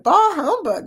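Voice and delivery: high, loud theatrical bah humbug; exclaimed. Shown as a demonstration. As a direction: proclaim it like Scrooge. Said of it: "Yeah, I said it".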